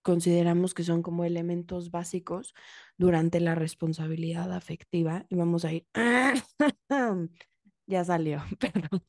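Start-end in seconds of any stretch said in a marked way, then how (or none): 5.9-7.3 sudden high laugh at her own slip; embarrassed little laugh; burst of laughter. Real, not acted. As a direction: laugh out, caught off guard.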